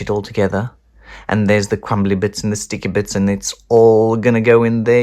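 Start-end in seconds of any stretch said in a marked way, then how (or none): none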